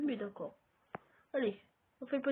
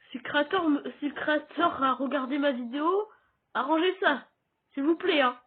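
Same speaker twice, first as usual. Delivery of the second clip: loud, raised-pitch plea to the creator; insistent appeal; 3 semitones higher, 6 dB louder than usual. Real, not acted. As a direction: call out a demand for a fix. (appel insistant, voix forte)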